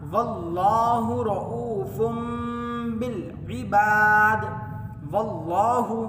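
slow, drawn-out tajweed chant; chanted Quranic recitation. Shown as a demonstration. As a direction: recite melodically, stretching the vowels. Quran recitation inside lesson.

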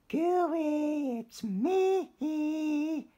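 high, loud little-fish call; Stanley calls to a golden fish, high and loud. Acted. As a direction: call out brightly as tiny Stanley. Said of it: "he called".

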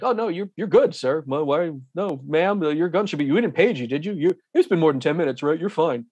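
acting out a flustered, stumbling clerk; mildly surprised, reassuring the customer in fits and starts; surprised airline agent. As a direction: lightly imitate an agent caught off guard. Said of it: "they'll be surprised to see you".

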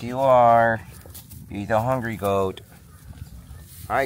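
ordinary talk

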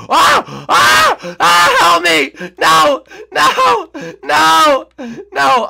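loud, very high screams for help; panicked screaming. Real, not acted. Player caught by the killer, screaming loud and high. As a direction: scream as the killer gets you.

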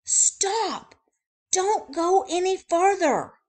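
high, loud warning stop; urgent shout. Acted. Squirrel shouting a warning at Wordy Birdie, high and loud. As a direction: call out to stop her. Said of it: "Squirrel zips in front".

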